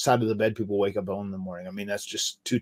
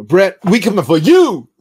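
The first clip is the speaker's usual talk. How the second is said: mock challenge; 8 semitones higher, 11 dB louder than usual. Calling out rival on podcast.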